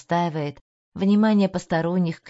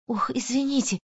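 higher, guilty apologetic mumble; 6 semitones higher than usual. A narrator's reading. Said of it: "виновато забормотала Марианна".

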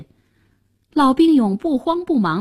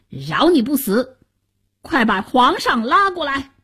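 firm, raised-pitch order; commanding hero; 4 semitones higher than usual. A narrator's reading. Hero sparing the cowering minister's life, commanding. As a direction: order them about like a victor.

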